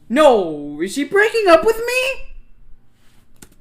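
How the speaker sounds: very loud, high, drawn-out wail; anguished high-pitched yelling; panicked wail. Real, not acted. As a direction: screech it slowly in over-the-top dismay. Player panics at the story.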